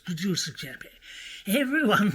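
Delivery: normal talking voice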